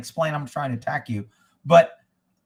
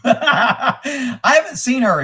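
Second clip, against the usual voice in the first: laughing; 6 semitones higher, 6 dB louder, much slower than usual. Real, not acted. Laughs at girlfriend poison comment.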